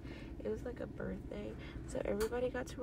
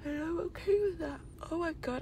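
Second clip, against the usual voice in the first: talking through a yawn; 8 semitones higher, 3 dB louder than usual. Real, not acted. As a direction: yawn while speaking. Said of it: "I'm so sorry I'm yawning".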